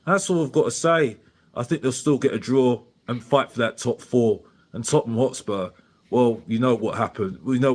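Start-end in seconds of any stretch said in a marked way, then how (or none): none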